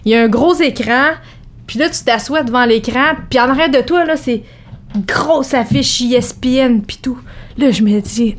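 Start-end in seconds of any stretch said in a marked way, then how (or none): none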